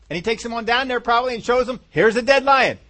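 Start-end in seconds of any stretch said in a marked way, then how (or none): 1.9-2.8 high, loud, triumphant reveal; proud showing-off. Acted. David shows his doubters the lion, proud and loud. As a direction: present the proof at full voice.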